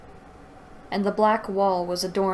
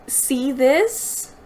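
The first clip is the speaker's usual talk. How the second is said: higher, louder scoffing echo; incredulous mock; 6 semitones higher, 4 dB louder than usual. Reader scoffing at the villain's one-liner, incredulous. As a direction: throw the line back with scorn.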